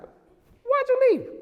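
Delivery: put-on very high, drawn-out kid's squeal; mock tearful whine. Tearful boy resisting his mom's hug, squeaky mock crying. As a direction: imitate a crying child accusing his mom. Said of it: "As he's crying".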